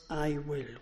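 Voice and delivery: "I will" said softly but with weight; stress on I WILL. Shown as a demonstration. Preacher quoting God's promise, quiet stress on I will. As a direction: press on "I" and "will". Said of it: "We are to lay emphasis on the words".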